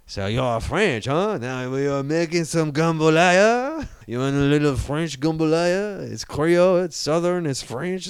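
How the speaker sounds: twangy Creole French, drawn-out words; Creole French accent. Shown as a demonstration. Podcaster showing co-host how French becomes Creole, hammed-up Creole accent. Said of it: "you just add a little twang".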